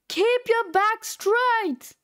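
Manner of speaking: high, stretched-out coach yell; shouted drill order. Acted. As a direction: holler it like a drill command. Coach drilling student in detention.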